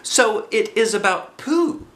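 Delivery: high, slow squeaky voice; small squeaky piglet voice; squeaked. A narrator's reading. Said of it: "he squeaked".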